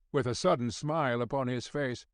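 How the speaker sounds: ordinary delivery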